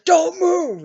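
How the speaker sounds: loud, high shout of a cop; mock cop yell. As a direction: bark the order. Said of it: "he says".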